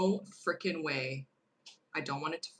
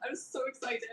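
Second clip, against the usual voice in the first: so excited; 6 semitones higher, 3 dB quieter than usual. Real, not acted.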